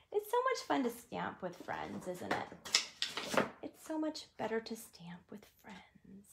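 soft whispered confidence. Real, not acted. Host confiding to stamping friends in a whisper. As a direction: tell it like a secret between friends.

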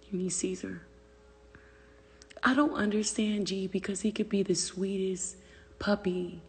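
usual speaking voice

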